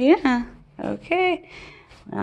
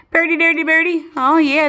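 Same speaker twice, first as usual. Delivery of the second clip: higher sing-song pet talk; 8 semitones higher than usual. Real, not acted. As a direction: coo it up high to the bird. Repeats higher to bird.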